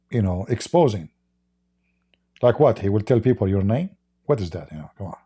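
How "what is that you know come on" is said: normal talking voice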